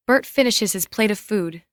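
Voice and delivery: ordinary delivery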